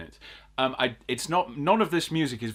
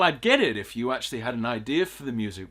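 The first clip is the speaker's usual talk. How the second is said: ordinary talk, nothing marked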